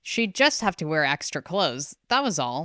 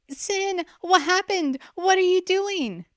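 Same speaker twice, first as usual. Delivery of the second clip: much higher, pleading in alarm; frantic sister. A narrator's reading. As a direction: beg to know. Sister rushes to her side.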